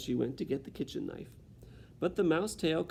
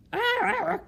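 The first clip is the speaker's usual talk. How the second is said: very high, loud shriek; shocked shriek; 9 dB louder than usual. Acted. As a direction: shriek at the sight. Cook sees mouse.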